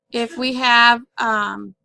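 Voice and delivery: ordinary delivery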